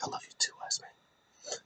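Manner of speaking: very soft, whispered reply; hushed imitation. Wrestler answering his wise man in the retelling, hushed whisper. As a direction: whisper it back, playing the wrestler. Solo's retold low reply.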